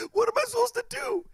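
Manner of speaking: very high mock-tearful wail; scared whining. Scared kid asking his friend for help, high whiny voice. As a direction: whine it like a terrified kid.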